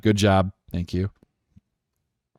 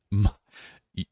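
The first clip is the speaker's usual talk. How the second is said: ordinary talk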